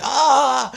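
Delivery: high, loud mock scream; one big hammed-up 'ah'; imitated pained scream. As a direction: yell out like the burning kid. Imitates boy screaming on floor.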